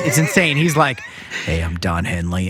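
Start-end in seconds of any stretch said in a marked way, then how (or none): 1.4-2.5 very low, soft impression of [name]; deep voice. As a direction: drop it way down, deep and quiet. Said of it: "the deepest fucking voice".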